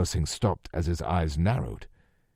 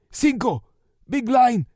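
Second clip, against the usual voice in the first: high, loud tearful yell; crying yell; 6 dB louder than usual. A narrator's reading. Captive yelling through tears at Lou. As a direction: cry it out in terror. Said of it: "the man yelled, crying".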